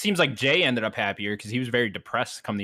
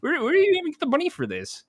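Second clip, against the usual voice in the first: high, outraged disbelief; incredulous squeal. Real, not acted. Gamer ranting at Vanessa, high squeal. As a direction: pitch it up in disbelief. Exasperated at a game character.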